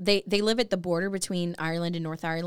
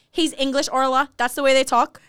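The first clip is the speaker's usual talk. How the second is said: put-on higher Northern Irish accent; Michelle's accent; 5 semitones higher, 6 dB louder than usual. Host quoting Michelle to Orla, Northern Irish accent. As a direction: explain it in a Derry accent. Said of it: "And Michelle says".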